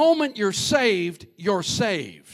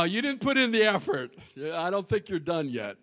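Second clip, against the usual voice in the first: usual speaking voice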